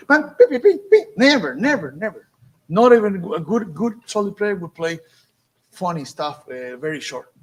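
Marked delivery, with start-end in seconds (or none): 0.1-1.1 high, loud sung beeps with a laugh; mocking bang-beep vocal imitation; mock choppy playing. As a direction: sing the bad staccato mockingly. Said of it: "He never play".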